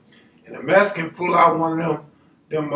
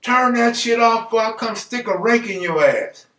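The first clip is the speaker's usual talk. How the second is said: higher, sustained holler out a window; mock yell; 6 semitones higher than usual. Bobby threatening gardeners out the window, mock yell. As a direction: imitate a threatening shout, pitched up. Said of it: "Bobby would just yell out their window".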